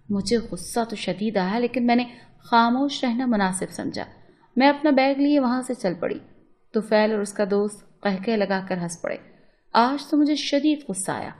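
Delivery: plain reading voice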